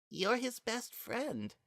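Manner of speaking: high, hushed and gentle; soft gentle voice. A narrator's reading. Frisk gently tells Kara why Azrael stopped him, soft and high. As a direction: speak softly, as if to calm a hurt friend.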